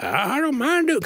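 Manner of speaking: very high, exaggerated Southern impression; mock Southern accent. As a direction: tease him with a high Southern twang. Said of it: "why are you so Southern".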